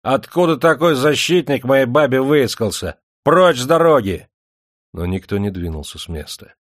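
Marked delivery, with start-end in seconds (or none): 0.0-4.3 loud, high angry bluster; drunken threat. Acted. Ermilka threatens the defender, loud and high. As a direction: drive him off the road.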